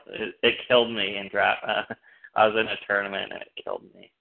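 normal talking voice

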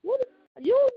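high-pitched, incredulous what, half laughing; replaying the moment she was accused, stunned; shocked disbelief. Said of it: "I was shocked".

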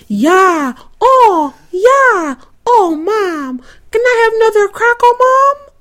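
put-on high, fast little boy asking for a cracker; imitating a little boy. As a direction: beg Mom like a small kid. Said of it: "And they were saying".

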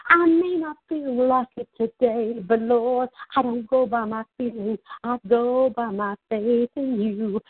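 preaching at full force to the congregation; fervent preaching. Acted. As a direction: press on with rising faith. Preaching rises in fervor.

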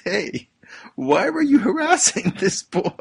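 high, loud mock teacher scolding. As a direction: playfully scold the bully as the teacher. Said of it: "The teacher goes up to the bully and says".

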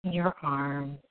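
slow, soft soothing meditation voice; guided meditation. Acted. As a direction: ease them gently into calm.

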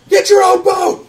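loud, high, drawn-out yell; hysterical kid. Acted. Kid shoving off alone, hysterical. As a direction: yell them away in a frantic young voice.

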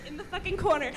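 normal talking voice

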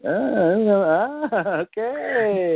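high, slow, dithering voice; drawn-out hesitant impression; hesitant mock dither. Imitating a hesitant collector.

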